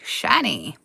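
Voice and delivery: hoard-loving goblin saying "shiny."; "shiny." growled low like a creature eyeing treasure; creepy shiny. Acted. Echo in a different voice.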